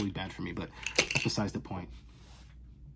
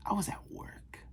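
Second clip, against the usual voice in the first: a voiceless, much higher whispered reveal; dramatic whisper; 11 semitones higher, 8 dB quieter than usual. Acted. Vlogger reveals where she was in a dramatic whisper. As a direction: drop the punchline in a hushed whisper.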